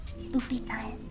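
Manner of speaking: ordinary talk, nothing marked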